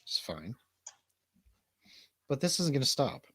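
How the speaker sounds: ordinary talk, nothing marked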